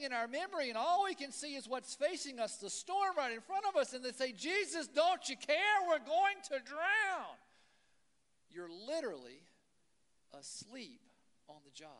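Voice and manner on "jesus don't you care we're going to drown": louder and higher, slowing at the end; desperate shout. Retold or imitated. Panicking disciples in the storm cry out to Jesus, loud and desperate. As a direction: cry out as the boat fills.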